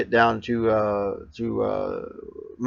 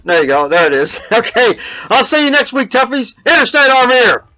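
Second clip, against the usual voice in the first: higher, louder hyped host voice; show sign-off; 13 semitones higher, 8 dB louder than usual. Acted. Vlogger signing off his show to fans in a hyped host voice. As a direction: pump up the viewers like a show host.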